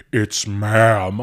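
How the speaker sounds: deep, drawn-out mock customer voice; mock-offended low voice, leaning on ma'am; indignant correction. Offended customer corrects the cashier, mock indignant.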